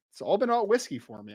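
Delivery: usual speaking voice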